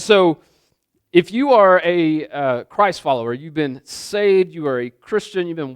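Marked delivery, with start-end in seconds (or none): none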